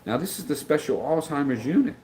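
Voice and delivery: normal talking voice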